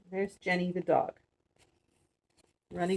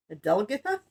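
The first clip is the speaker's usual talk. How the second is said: plain reading voice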